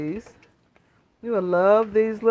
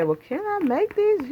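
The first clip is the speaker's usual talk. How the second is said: lightly voiced eager asker, pitched up; curious viewer; 9 semitones higher than usual. Fans asking Sandra if they can cook it, lightly imitated eager question. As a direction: mimic a hopeful viewer asking. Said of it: "a lot of people say".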